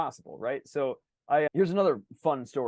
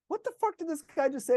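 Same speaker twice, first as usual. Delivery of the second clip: much higher, baffled inner outcry; incredulous; 14 semitones higher than usual. Retold or imitated. Patient reacting to the doctor's advice, high incredulous outcry. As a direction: sound floored by what he just said.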